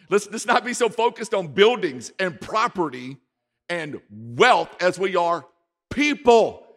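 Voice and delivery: loud, high preaching cry; preaching shout. Real, not acted. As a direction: press it home to the congregation. Preacher exhorts at full force.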